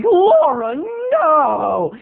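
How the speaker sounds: high wail of shock; mock scandalized whine. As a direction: cry it out in mock horror. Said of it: "I'm like".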